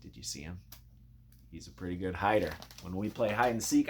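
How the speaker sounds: plain reading voice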